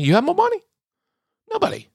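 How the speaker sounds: high, silly questioning squeak; mock squeaky voice. Ranting about money, mock squeaky voice. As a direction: keep the cartoonish mockery going.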